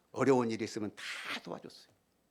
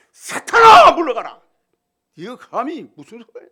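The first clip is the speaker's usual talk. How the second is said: very high, loud scolding of Satan; Jesus rebuking Peter sharply; stern rebuke; 13 semitones higher, 26 dB louder than usual. Acted. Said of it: "야단을 친 거라". (베드로를 꾸짖는 예수님의 호통)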